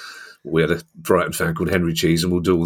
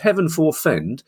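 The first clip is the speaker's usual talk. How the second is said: mock Henry Cheese; 9 semitones higher, slower than usual. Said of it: "a very Henry Cheese thing to say".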